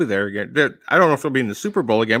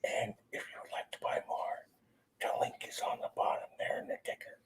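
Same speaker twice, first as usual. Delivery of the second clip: whispered prompt then repeated; 20 dB quieter than usual. Shown as a demonstration. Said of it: "let me feed you the line".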